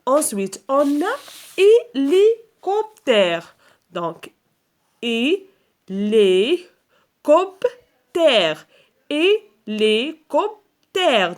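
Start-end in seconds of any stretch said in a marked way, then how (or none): 1.6-3.6 high, loud, slow hé-li-cop-tère; syllable-by-syllable. Shown as a demonstration. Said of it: "فين كنسمعوا le son A". 5.0-11.4 very slow hé... li... cop... tère; long pause after each syllable of hélicoptère. Shown as a demonstration. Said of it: "فين كنسمعوا le son A".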